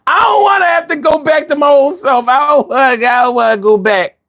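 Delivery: high whiny wail, easing down; whimpering comic plea; mock crying. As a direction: plead tearfully, hammed up.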